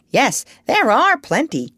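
high, emphatic parrot; bossy parrot. A narrator's reading. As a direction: answer back as a know-all bird. Parrot answers the doctor.

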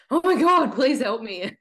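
high, loud breathless begging; excited plea. Retold or imitated. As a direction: gush it out, overjoyed and desperate. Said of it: "a very excited email to you being like".

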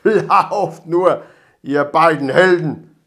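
loud mocking call; calling after. A narrator's reading. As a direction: yell it after the runaways. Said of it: "rief er ihnen nach". (spöttisch nachgerufen)

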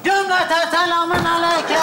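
calls out a big salaam to everyone. Acted. Loud greeting on entering.

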